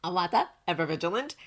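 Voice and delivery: ordinary delivery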